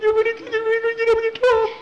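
very high, loud, fast squeal; frantic falsetto jabber; panicky cartoon sidekick. Skeeter panicking behind the rock.

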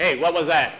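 loud startled demand; yelling. Acted. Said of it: "yelled at the sheriff".

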